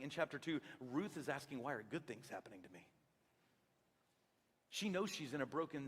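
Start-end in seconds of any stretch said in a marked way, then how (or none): 1.6-2.9 soft, low amazement; hushed wonder. Pretend. Ruth wonders at her blessings, hushed. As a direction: ask it in quiet gratitude. Ruth's grateful question.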